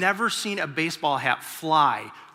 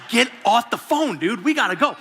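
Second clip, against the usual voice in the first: impatient dad; 5 semitones higher, 3 dB louder than usual. Retold or imitated. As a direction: bark it at the kid on his bed. Dad hurrying son off phone.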